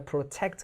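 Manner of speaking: protect with the second syllable TECT stressed. Shown as a demonstration. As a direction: put the weight on TECT in protect. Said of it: "강세가 뒤에 있죠".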